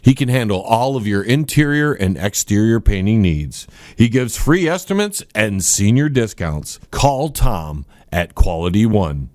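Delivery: low, steady radio ad voice; ad read. Acted. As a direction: read it like a local radio spot.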